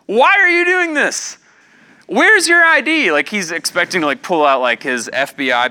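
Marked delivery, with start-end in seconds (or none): 0.0-3.1 indignant challenge. Retold or imitated. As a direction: demand it loud and high, slowly at first.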